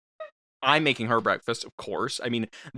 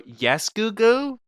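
high, slow, sing-song; sweetly patient mom; exaggerated sweetness; 9 semitones higher, much slower than usual. Mom answering sighing daughter.

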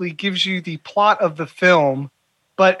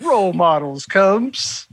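slow, drawn-out title reveal; mock trailer announcer; much slower than usual. Cohost topping the host's joke credit, mock trailer announcer. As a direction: stretch it out like a hammy trailer.

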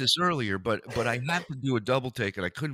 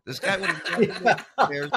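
fast, louder, laughing it out; laughing, much higher; 7 semitones higher, 6 dB louder, faster than usual. Real, not acted. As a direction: crack up at the thought. Laughing at the cane joke.

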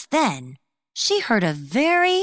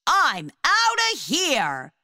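deer yelping; 9 semitones higher, slower than usual. A narrator's reading. Deer bitten and fleeing.

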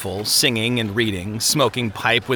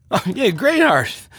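put-on high, loose drunken recognition; slurred drunk; 10 semitones higher than usual. A narrator's reading. Drunk man recognizes his old friend, slurring happily. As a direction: slur it with tipsy delight. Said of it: "he slurred".

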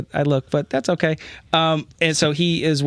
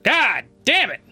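outburst; 12 semitones higher, slower than usual. Acted. General's outburst.

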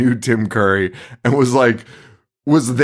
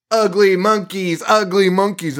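slightly slowed, higher mimicked ape line; lightly voiced Amy; 6 semitones higher than usual. Retelling Amy's arrival among gray gorillas, higher mimicking voice. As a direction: lightly imitate a simple talking gorilla, pitched up.

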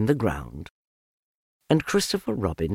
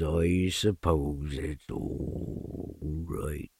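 low, slow, mournful donkey drone; deep, dragging, defeated donkey voice; gloomy Eeyore; 7 semitones lower, much slower than usual. Acted. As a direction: sigh each word out like a sad old donkey.

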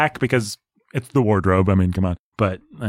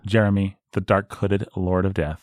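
mock-ominous; 3 semitones lower than usual. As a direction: intone it darkly and slowly. Announces dark lord title ominously.

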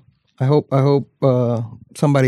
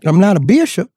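high, drawn preacher retort; Southern preacher voice; 5 semitones higher than usual. Acted. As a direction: repeat it in the preacher voice. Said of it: "don't say it like that".

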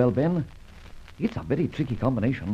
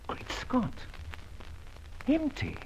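much higher, breathless shock; shocked surprise; 13 semitones higher, 8 dB quieter, slower than usual. Acted. Safecracker discovering the safe is empty, stunned. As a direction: gasp it out, stunned.

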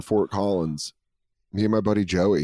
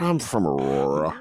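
lower, plain Colorado voice; a lower, sheepish reveal; dropped accent; 7 semitones lower than usual. Retold or imitated. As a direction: drop the Russian act at the end. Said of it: "doing a Russian accent".

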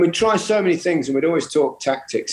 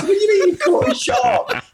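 laughing disbelief. Retold or imitated. Retelling his own disbelieving reply.

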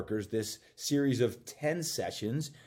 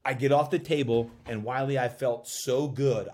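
ordinary talk

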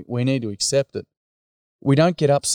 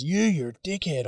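higher, retold angry accusation; angry boy; 7 semitones higher, 4 dB quieter than usual. Boy insulting the workshop leader, mock angry. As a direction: imitate an angry lad without shouting. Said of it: "he was all angry".

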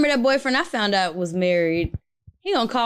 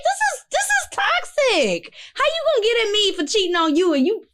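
outraged disbelief; 12 semitones higher than usual. Retold or imitated. To a married boyfriend accusing her of cheating, high appalled disbelief. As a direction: toss it back at him, scandalized and high.

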